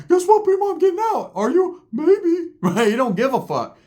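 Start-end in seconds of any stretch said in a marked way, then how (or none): 0.1-2.5 very high, squeaky mock voice; high cartoon voice imitation; Ricky's voice.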